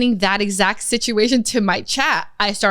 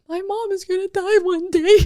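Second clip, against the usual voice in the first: high, tearful, half-broken line; teary realization; 10 semitones higher than usual. Retold or imitated. Daughter on a call with mom, tearful and high. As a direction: let the voice crack with sudden grief. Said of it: "tears were sob".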